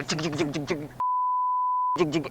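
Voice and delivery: steady choppy rotor noise; imitated helicopter. Teller imitating helicopter between sheriff's calls, rotor chatter. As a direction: keep the blades chopping evenly. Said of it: "We hear this helicopter".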